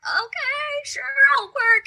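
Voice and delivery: high squeaky mock compliance; mock the overworked people-pleaser agreeing to everything; pushover yes-voice. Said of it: "previously I've been like".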